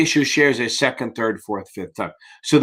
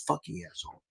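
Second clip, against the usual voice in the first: a much softer, under-the-breath mock curse; muttered curse; 2 semitones higher, 19 dB quieter than usual. Tutor retelling his reaction to Drew, muttered softly. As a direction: mutter it to yourself. Reacting to student who skipped book.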